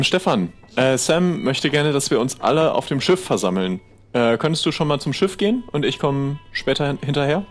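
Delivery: normal talking voice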